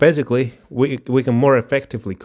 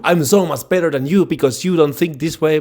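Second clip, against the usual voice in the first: higher, taunting superior voice; mocking smug put-down; 6 semitones higher than usual. Quoting a rude arguer, mocking smug put-down. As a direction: sneer it like a know-it-all.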